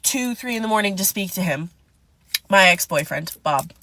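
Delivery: usual speaking voice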